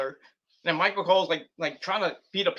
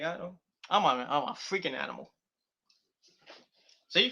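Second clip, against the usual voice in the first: ordinary talk, nothing marked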